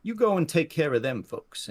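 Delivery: lightly voiced outlaw giving orders; older robber handing out a job; older man. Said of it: "looks back to his companion and goes".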